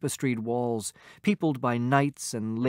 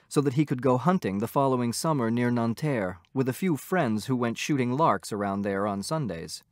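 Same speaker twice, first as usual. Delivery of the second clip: plain reading voice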